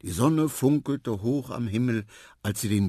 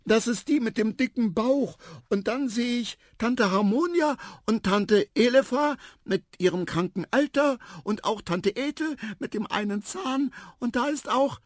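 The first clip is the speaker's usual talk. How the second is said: put-on high, eager child's voice; excited elephant child; 3 dB louder than usual. A narrator's reading. Little elephant pointing out his aunts, high excited voice. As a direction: rattle off the family, high and eager.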